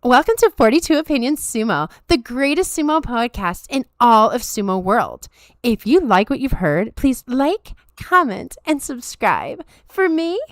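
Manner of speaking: put-on high, swooping Valley Girl plug. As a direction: read the plug as a really annoying Valley Girl. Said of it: "get the Valley Girl".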